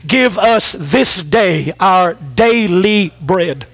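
"Give us this day our daily bread" drawn out slow and low. Shown as a demonstration. Pastor quoting the Lord's Prayer to the church, slow and low. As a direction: read each word very slowly and low, like laying it on the table. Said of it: "Notice this statement he says right here".

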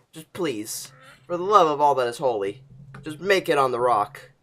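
ordinary talk

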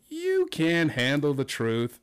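slow, drawn-out, odd-sounding reply; slow spin. Shown as a demonstration. Host showing a new spin on the line, slow and drawn out. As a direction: stretch every word out slowly. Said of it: "but delivered differently".

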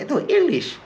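high, insistent mock American answer; mock American reply. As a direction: pitch it up like a local insisting. Americans answering, acted exchange.

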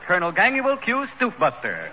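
ordinary talk, nothing marked

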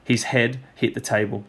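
usual speaking voice

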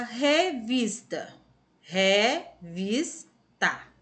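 revista drawn out slowly, syllable by syllable. Shown as a demonstration. Teacher splitting revista for pupils to find the strong syllable, syllable by syllable. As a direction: break revista into slow, separate syllables. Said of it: "pronunciou com mais intensidade, mais forte".

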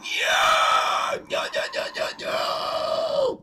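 loud, breathy shouted cheer turning to protest; mock roaring shout. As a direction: roar it out like a beast. Dragon roars in reply.

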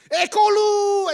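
long, high referee's yell; starting shout. Acted. Referee starting a sled race, drawn-out shout. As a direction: holler the final count to launch the race.

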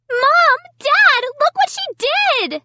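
high, loud accusing scream. A narrator's reading. Sister showing parents the damage, screaming. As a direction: scream for Mom and Dad, very high. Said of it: "Sarah screamed".

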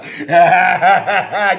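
loud derisive guffaw; scornful laugh. Acted. Man scoffing at love, scornful laugh. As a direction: laugh off all that romance.

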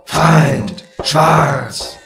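loud, drawn-out single words; towers' repeated cry. A narrator's reading. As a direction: boom each word like a guard. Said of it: "als die beiden Türme wiederholten".

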